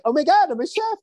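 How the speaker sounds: high-pitched mock amazement; imitating a delighted film heroine, pitched high; excited movie quote. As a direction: squeal it like a thrilled discovery. Retelling a movie character's line.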